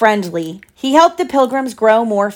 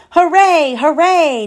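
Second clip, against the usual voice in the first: high, bright little cheer for the pilgrims; excited cheer; 8 semitones higher, 3 dB louder, slower than usual. Acted. Pilgrims spotting land, cheering high and happy.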